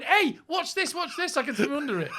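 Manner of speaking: put-on high, loud bragging kid; a cocky child showing off. Mocking him as a show-off kid.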